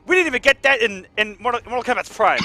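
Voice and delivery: excited. Real, not acted. Hyped caster reacts to double Cyrax.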